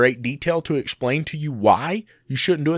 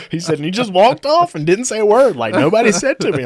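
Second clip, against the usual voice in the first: laughing telling; 7 semitones higher, 7 dB louder than usual. Real, not acted. Laughing while telling the punchline.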